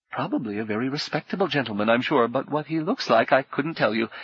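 a jittery tavern keeper babbling to appease a dangerous guest; nervous innkeeper. Acted.

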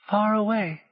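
muffled against a chest. Acted. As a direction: speak with face pressed into chest. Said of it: "Gally's voice was muffled in his chest".

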